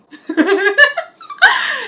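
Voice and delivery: high loud real laugh; burst of laughter. Real, not acted. Laughing at Andrew after the fright, loud high laugh. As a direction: crack up after the scare.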